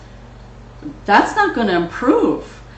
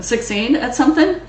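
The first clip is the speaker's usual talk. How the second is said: ordinary delivery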